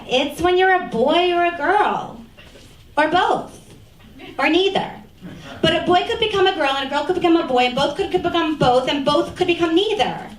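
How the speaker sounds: put-on six-year-old child. Six-year-old explains gender to mom, imitated high child voice. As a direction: lightly voice a small child, rattling on. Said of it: "my six-year-old says".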